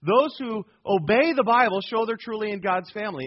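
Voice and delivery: ordinary talk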